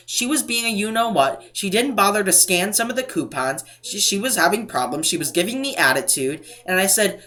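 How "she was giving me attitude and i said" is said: ordinary delivery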